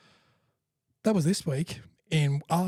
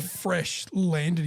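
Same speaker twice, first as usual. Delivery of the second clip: normal talking voice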